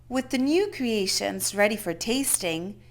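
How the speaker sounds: usual speaking voice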